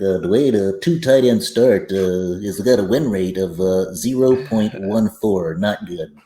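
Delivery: a light late-night host impression, not far from his own voice; Johnny Carson voice. Joking about a bad win rate, as a lightly voiced talk-show host. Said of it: "doing that in a Johnny Carson voice".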